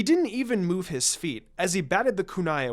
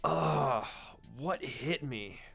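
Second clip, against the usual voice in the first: slow, soft, dazed; waking up with a pounding head; groggy groan; 4 semitones lower, 8 dB quieter, much slower than usual. A narrator's reading. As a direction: sluggish mumble. Groggy boy waking with headache.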